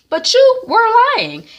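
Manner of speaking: high, loud mock accusation; retelling a TV couple's fight; accusing yell. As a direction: fling the accusation at him. Retold soap scene, accusation.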